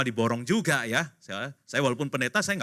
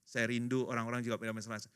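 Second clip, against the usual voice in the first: ordinary delivery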